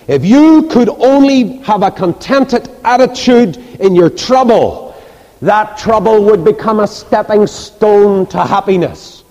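high, slow, emphatic exhortation; preaching. Real, not acted. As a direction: drive each phrase home slowly from the pulpit. Preaches at full force.

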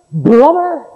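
brother thrown up high in disbelief; incredulous echo. Acted. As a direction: repeat it as if you can't believe it. Preacher echoes the word in disbelief.

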